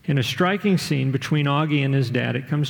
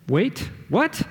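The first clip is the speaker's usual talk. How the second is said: high, rising double-take; disbelief; 7 semitones higher than usual. A narrator's reading. Augie reacting to his dad's admission, in disbelief. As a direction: jump up in pitch as if you misheard. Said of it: "I honestly didn't think I heard him right".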